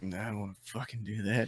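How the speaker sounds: low, quiet, reluctant grumble; grumbling Norman. Retold or imitated. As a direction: mutter it like you really don't want to go. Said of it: "He's like".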